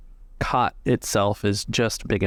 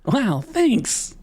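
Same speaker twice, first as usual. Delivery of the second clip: high, eager little deckhand voice; grateful deckhand; 13 semitones higher than usual. Acted. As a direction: chirp the thanks like a young sailor.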